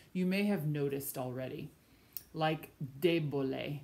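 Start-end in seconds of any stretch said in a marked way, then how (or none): 3.0-3.8 debole said DE-bo-le; stress on first syllable. Shown as a demonstration. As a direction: hit the DE of debole, not the middle. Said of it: "The stress falls on the first syllable".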